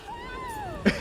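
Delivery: short, very high giggle; incredulous laugh. Real, not acted. Player seeing the chute fail, incredulous laugh. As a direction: burst into a squeaky laugh.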